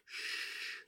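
soft, soothing shushing; whispered hush. A narrator's reading. Father comforting sobbing Ben in the car, soft whispered hush. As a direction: hush a crying child very softly. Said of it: "whisper over and over again".

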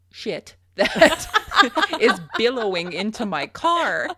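laughing through the words, high and loud. Real, not acted. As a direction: crack up while telling it. Laughing at steam billowing in car.

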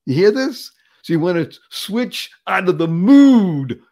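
usual speaking voice

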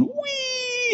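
put-on squealed high and soft like a kid on a ride; mock-childish delight on a Ferris wheel; a high breathy squeal of fun; gleeful whee. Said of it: "Christians going".

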